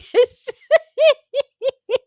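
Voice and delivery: loud high burst of laughter; laughing out loud. Real, not acted. Bursting into laughter at the woman's crocodile plan. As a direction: crack up hard, high and loud. Cracking up at the clip.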